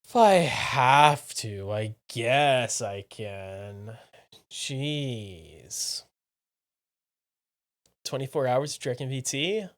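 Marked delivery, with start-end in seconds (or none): 0.1-6.0 slow, loud, begrudging whine; reluctant drawn-out groan. Pretend. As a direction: drag it out like a chore.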